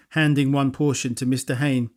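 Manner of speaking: ordinary delivery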